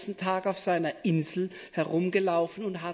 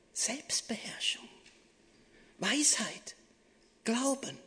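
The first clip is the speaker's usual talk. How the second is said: soft unvoiced whisper; 3 semitones higher, 5 dB quieter than usual. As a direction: breathe the word like a secret. Whispered fruit of spirit.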